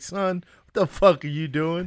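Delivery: a hint of a stern officer; mock authority, drawn out; lightly voiced cop. Voicing an angry cop.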